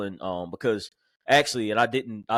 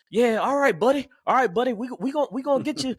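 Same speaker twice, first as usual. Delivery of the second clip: country sergeant; 12 semitones higher than usual. Retold or imitated. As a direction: play the big country sergeant reassuring him. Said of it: "this big country guy".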